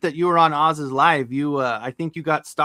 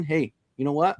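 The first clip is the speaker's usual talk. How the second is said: ordinary talk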